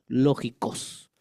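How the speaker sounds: lógicos said slowly, stress on the O; Greek lógicos. Shown as a demonstration. Preacher teaching the Greek word, slow and careful. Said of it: "con K de kilo y acento en la O".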